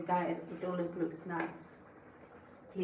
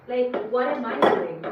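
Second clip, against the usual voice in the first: loud, raised indignant retort; acted exasperation; 4 semitones higher, 9 dB louder than usual. Trash carrier answering a needless reminder, exasperated. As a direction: throw the obvious back at a nag, loudly. Acts exasperated reply to the nag.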